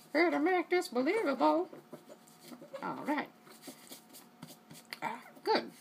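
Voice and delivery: high, hammed-up British accent; a bad, lofty British accent from a ringside commentator; terrible British accent. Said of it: "Terrible British accent".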